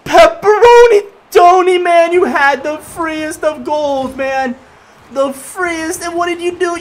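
loud, high, drawn-out yelling at a teammate; exasperated rant at the AI teammate; frustrated yell. Real, not acted. Gamer yelling at teammate who missed an open goal, exasperated.

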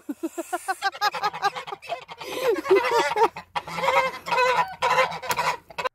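squealing giggle; high squealing laugh. Real, not acted. Goats nibbling her hand, she squeals with laughter.

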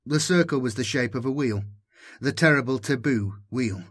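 usual speaking voice

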